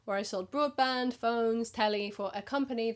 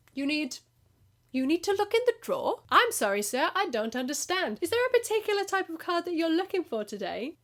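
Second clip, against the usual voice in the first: high, overly polite shop assistant voice; mock innocent clerk; 7 semitones higher, 4 dB louder than usual. Shop clerk feigning innocence to a shifty customer, high and polite. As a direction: play dumb sweetly, pitched up. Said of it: "and I'm like".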